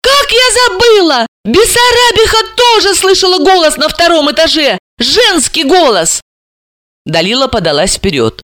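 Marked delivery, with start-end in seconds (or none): 0.0-6.2 high-pitched burst of realisation; sudden excited cry. A narrator's reading. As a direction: blurt it out excitedly. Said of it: "вдруг закричала она".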